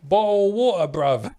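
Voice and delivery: high, loud mock London accent; hammed-up London lad call. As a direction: belt it out as a Cockney. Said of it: "London voice lines".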